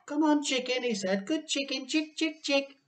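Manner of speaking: high, sing-song chick chick call; coaxing the chicken. A narrator's reading. George crouched in the yard coaxing a chicken, high coaxing call. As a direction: coax it close gently.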